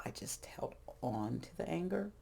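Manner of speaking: drops to a breathy whisper; whispering regretfully about holding on; whispered aside. Real, not acted. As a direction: hush it like a confession. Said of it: "whispered aside".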